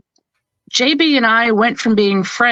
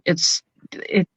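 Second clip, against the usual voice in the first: ordinary talk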